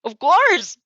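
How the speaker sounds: high, loud rushed reassurance; hurried confirmation. Acted. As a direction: blurt it out fast and high. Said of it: "you hurry to confirm".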